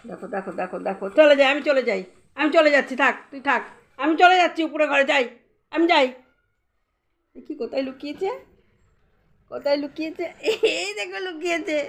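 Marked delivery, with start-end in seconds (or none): 1.1-6.1 higher, louder playful threat to go; mock leaving. Pretend leaving to tease toddler. 7.4-11.8 higher, laughing hide-and-seek voice; playful peekaboo. Real, not acted.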